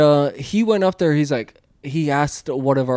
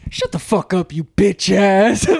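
trash-talking coworker; 5 semitones higher, 3 dB louder than usual. Retold or imitated. Coworker insulting the old man in the warehouse fight. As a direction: taunt him mercilessly. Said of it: "He's like".

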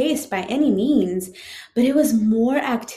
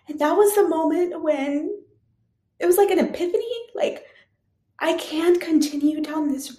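real emotion welling up, pitch climbing; tearing up; 7 semitones higher than usual. Real, not acted. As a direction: voice rising high and tight with emotion. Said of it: "I'm sorry if I tear up here".

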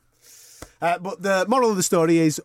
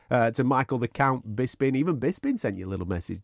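ordinary talk, nothing marked

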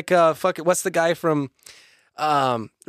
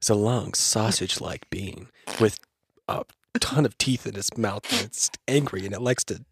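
midnight voice; 6 semitones lower, 3 dB quieter than usual. Pretend. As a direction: drop it down into a hushed midnight radio read. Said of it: "do that in midnight voice".

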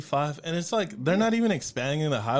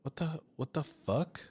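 stunned quiet; 2 semitones higher, 8 dB quieter than usual. Retold or imitated. Confused after emergency message.

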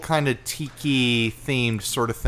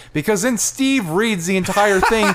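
laughing; 8 semitones higher, 6 dB louder than usual. Real, not acted. Laughing while telling the story.

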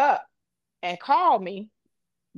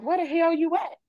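high, urgent demand on the phone; alarmed; 9 semitones higher than usual. Retold or imitated. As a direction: pitch it up in sudden worry. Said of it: "I'm like".

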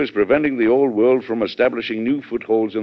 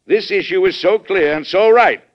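higher and louder with fierce conviction; impassioned declaration; 12 semitones higher, 8 dB louder than usual. Acted. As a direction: proclaim it like an oath to the nation. President's rising conviction to secretary.

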